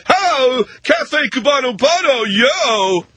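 high, showy hollered barista flourish; mocking a showboating barista yelling out an order; cocky Tom Cruise bartender. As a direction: sell the coffee like a flashy cocktail bartender. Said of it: "thinks he's Tom Cruise in Cocktail going".

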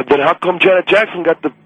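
normal talking voice

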